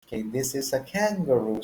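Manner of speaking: ordinary talk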